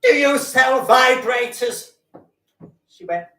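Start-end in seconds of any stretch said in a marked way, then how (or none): 0.0-1.9 high, slow, loud put-on lady's voice; customer voice. Comic telling a shop story, loud high customer voice. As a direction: stretch it out high and shouting. Stand-up acting out shy customer.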